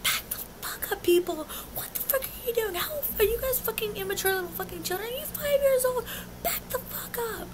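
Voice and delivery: high-pitched yelling held down in volume; mock yell. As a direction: imitate cursing out a crowd, softly but high. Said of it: "I'm yelling, cursing at the people".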